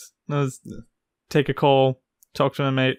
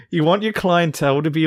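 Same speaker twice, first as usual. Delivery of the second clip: ordinary talk, nothing marked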